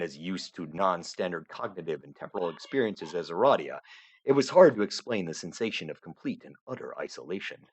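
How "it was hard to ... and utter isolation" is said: usual speaking voice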